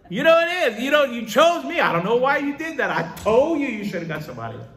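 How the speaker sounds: high, loud, hammed-up complaint; exasperated protest. Reluctant servant telling God he chose wrong, hammed-up exasperated protest. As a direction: protest in a strained high voice, coming down at the end.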